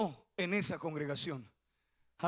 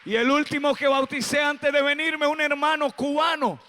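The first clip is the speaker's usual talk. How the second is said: rising to a shouted, high preacher's call; fiery preaching, louder and higher; 4 semitones higher, 7 dB louder than usual. Acted. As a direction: build the sermon up to a loud, high climax. Pastor preaching at full force.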